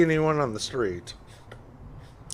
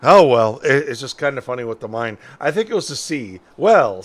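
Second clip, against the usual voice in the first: normal talking voice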